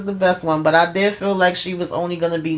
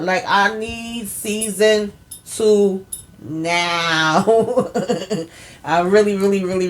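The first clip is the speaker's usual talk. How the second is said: drawn-out plea; 2 semitones higher, slower than usual. Real, not acted. Begging for another season.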